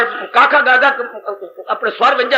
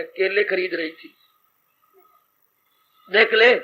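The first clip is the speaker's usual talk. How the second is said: ordinary talk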